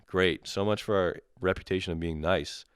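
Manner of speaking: ordinary talk, nothing marked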